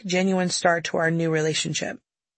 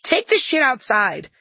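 high, slow, forceful order; mom yelling; 7 semitones higher, 4 dB louder, slower than usual. Acted. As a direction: yell it like a fed-up mother. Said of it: "my mom began to yell".

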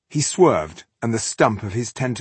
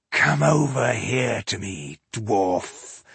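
slow, menacing monster growl; much slower than usual. Acted. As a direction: drag it out like a beast. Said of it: "he growled".

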